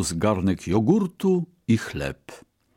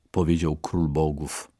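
ordinary delivery, nothing marked

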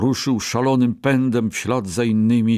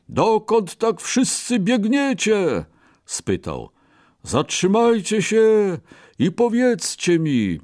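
elephant voice; 11 semitones higher than usual. Acted. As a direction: call after the fleeing animals. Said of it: "zatrąbił słoń". (słoń woła za uciekającymi)

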